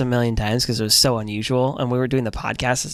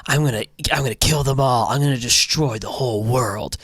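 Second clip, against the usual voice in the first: a hint of creepy demon voice; lightly put-on sinister villain; super creepy; 2 semitones lower than usual. Imitating Aaron vowing destruction, mildly creepy. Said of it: "in that super creepy way".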